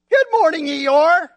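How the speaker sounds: a bright sing-song hello to a gloomy friend; chirpy morning greeting, high and loud; cheery Pooh. Acted. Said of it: "Said Winnie the Pooh".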